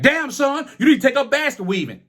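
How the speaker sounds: high taunting imitation of the instructor; mocking instructor. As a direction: sneer it high, like the teacher mocking him. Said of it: "saying some shit like".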